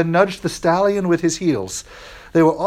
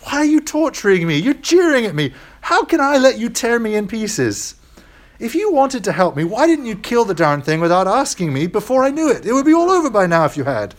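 high, tense whine of a ghost under torment; desperate ghost; 7 semitones higher than usual. Acted. Ghost accusing the angel of torturing him, high and desperate. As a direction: plead and accuse in a raised, anguished ghost voice.

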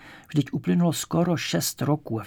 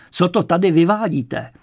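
loud, raised, outraged demand; director's roar; 5 semitones higher, 7 dB louder than usual. A narrator's reading. Director rushing up to the noisy clerk, roaring. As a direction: burst out yelling at the culprit. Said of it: "řval ředitel".

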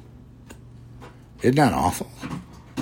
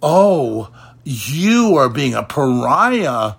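slow, higher, sneering mock piety; mocking imitation; 5 semitones higher, slower than usual. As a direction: parody them, drawn out and raised. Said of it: "you dare say".

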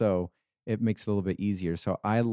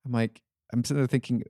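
ordinary delivery

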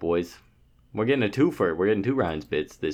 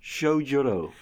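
town crier; 4 semitones higher, slower than usual. Pretend. Herald names challenger.

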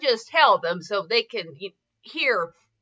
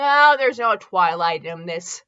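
normal talking voice

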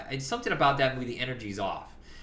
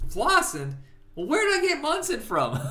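quoted puzzled reply; 13 semitones higher than usual. Retold or imitated. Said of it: "He goes".

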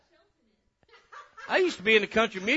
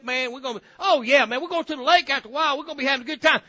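high, loud, excited greeting and chatter; hammed-up happy-go-lucky pal; carefree party buddy; 6 semitones higher, 6 dB louder than usual. Preacher playing the unbothered offender planning a party, excited.

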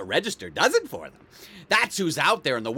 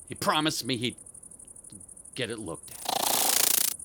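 ordinary talk

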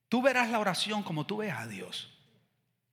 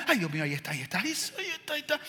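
nervous, rushed "ahí está"; mock panic; 4 semitones higher, 4 dB quieter, much faster than usual. Nervous suitor spotting the girl at the party. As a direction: fluster like a smitten teen. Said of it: "estás como".

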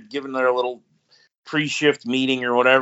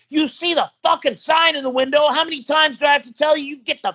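much higher, mock furious yell; angry shouting owner; 14 semitones higher than usual. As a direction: bark at them like the angry shop owner. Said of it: "He goes".

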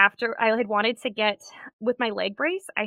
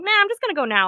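high, loud snap decision; suddenly decide, brighter and louder; 11 semitones higher, 5 dB louder, much faster than usual. Retold or imitated.

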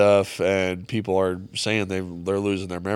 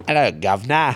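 hammed-up English accent, higher pitched; hillbilly English; 4 semitones higher, 6 dB louder than usual. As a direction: try a bad English "guv'nor" greeting. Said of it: "try to do English".

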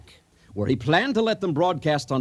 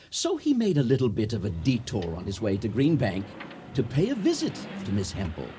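ordinary talk

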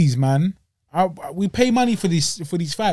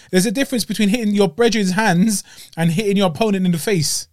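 usual speaking voice

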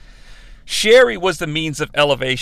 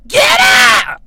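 high, loud screeching yell; hammed-up furious shriek; Sherry Martel; 9 dB louder than usual. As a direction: scream it in an imitation. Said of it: "to yell at the cameraman".

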